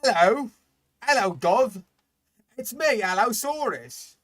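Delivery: high, loud silly Cockney; hammed-up Cockney dinosaur calling out; mock Cockney. Voicing the Cockney dinosaur, loud high Cockney. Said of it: "is that what he's saying?".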